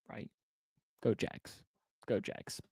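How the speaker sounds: whispered cheer under breath; whispering. Real, not acted.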